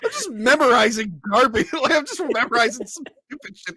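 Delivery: high, loud laughing protest; giggling denial of being a poet. Real, not acted. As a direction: laugh it off, embarrassed.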